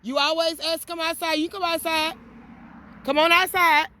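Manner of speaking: high, loud, put-on sassy voice; voicing the dog's thoughts. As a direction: talk back as if the dog. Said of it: "Look at him like, she didn't say that".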